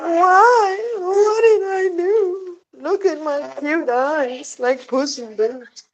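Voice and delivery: high, slow, mock-crying cat plea; whiny cat voice. Owner voicing her crying cat pleading like Puss in Boots, high and whiny. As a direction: play the cat begging with big sad eyes. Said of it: "She's like".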